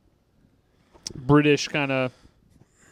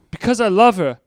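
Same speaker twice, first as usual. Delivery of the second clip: higher, louder earnest plea; quoting Sean; 7 semitones higher, 6 dB louder than usual. Retold or imitated. Sean defending his need to see Liz, higher and louder. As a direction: declare it like a lovesick hero. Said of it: "this is when Sean's like".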